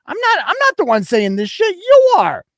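heated and high, peaking at the end; indignant retort. Real, not acted. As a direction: throw the blame back, voice climbing. Throwing blame back at her.

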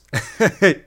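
quick high chuckle; short laugh. Real, not acted. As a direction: let out a brief, loud giggle. Laughs at own ranting.

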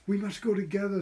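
high, loud anxious plea; insisting they go together; pleading Edith. A narrator's reading. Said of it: "said Edith".

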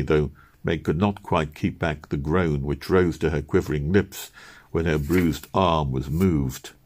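usual speaking voice

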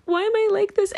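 high squeal. Real, not acted. Self-mocking squeal.

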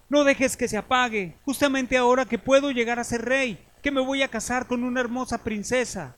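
high, trembling plea; begging. Acted. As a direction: beg desperately for his life. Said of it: "le rogó".

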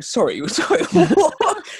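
high, laughing, incredulous sorry-what; cracking up in disbelief at the news. Retold or imitated. Hears car stopped by police.

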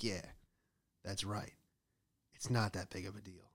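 quietly pleased, said through a smile; smug smile. Acted. Worker walks away having got out of trouble, quietly smug. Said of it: "walk away smiling like".

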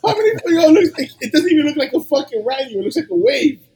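can barely believe it, laughing through it; laughing excitement. Real, not acted. Telling hosts about the rat swarm, laughing and excited.

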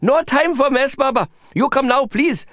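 high, pleading Indian friend voice; Prabhakar's high excited plea; urgent Prabhakar. Acted. Prabhakar urging Lin.

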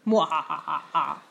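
slow, mock villain cackle; hammed-up evil laugh; maniacal laughter. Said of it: "Insert maniacal laughter here".

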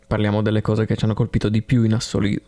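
normal talking voice

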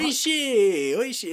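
high, loud hawker's cry easing off at the end; high-pitched sales call, tailing off in volume; street vendor's shout. Retold or imitated. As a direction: imitate a vendor yelling out his wares. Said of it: "he's just yelling it".

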